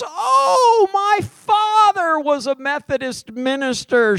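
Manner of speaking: put-on high excited old-woman voice; quoting the woman. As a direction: lift way up as the chatty lady. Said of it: "she goes".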